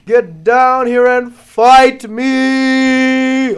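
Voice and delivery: very slow, loud, high challenge; drawn-out yell. Real, not acted. Player challenging the dragon, drawn-out loud yell. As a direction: bellow it out word by word.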